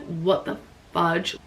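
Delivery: soft, slow stunned what the fudge; mock disbelief. Told by airline the flight is wrong, slow hushed disbelief. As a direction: let it fall out slow and hushed.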